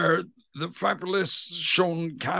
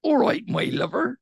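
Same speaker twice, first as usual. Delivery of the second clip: higher-pitched Bristol greeting; Bristol accent; 6 semitones higher than usual. Shown as a demonstration. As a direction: greet them like a Bristol local. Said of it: "In Bristol, they'd say".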